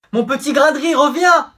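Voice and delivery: high, loud calling out; calling after her. Acted. Boyfriend calling his leaving girlfriend back, loud.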